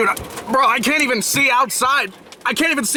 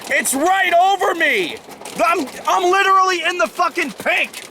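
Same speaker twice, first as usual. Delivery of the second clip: high, tense and urgent; alarmed excitement; 9 semitones higher than usual. Real, not acted. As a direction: panicky storm chaser excitement. Storm directly overhead on radar.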